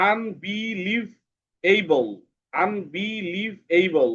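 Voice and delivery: "আনবিলিভএবল" slowly drawn out in five beats; syllable by syllable. Shown as a demonstration. Teacher quizzing pupils on syllables, "আনবিলিভএবল" slowly divided. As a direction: stretch each syllable of "আনবিলিভএবল". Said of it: "কয়টা syllable আছে এখানে".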